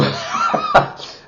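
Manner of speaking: high, bubbly laugh; squealing laugh of pleasure; delighted laugh. Real, not acted. Delighted by the nose.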